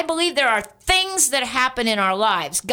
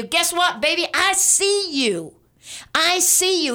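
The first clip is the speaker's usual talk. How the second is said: tender reassurance; 6 semitones higher than usual. Retold or imitated. God tenderly speaking to listener.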